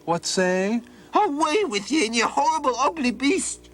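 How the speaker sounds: put-on high panicked cry of an old man; frightened scream. Old man screams at Donald from the window, high and panicked. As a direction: shriek it from upstairs in fright. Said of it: "screamed out the window".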